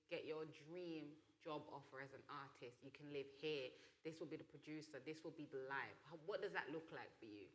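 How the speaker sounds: ordinary talk, nothing marked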